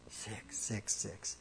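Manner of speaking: slow, low, hushed and ominous; mock-spooky hush. Preacher repeats the scary number to his congregation, ominous hush. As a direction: drop to a slow, eerie whisper.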